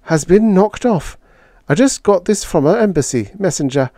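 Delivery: breathless and high, words rushing out. Acted. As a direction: gasp it out, short of breath. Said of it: "she said breathlessly".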